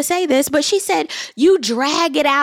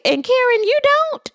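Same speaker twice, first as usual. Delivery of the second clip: incredulous retort. Retold or imitated. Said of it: "I'm sitting here like".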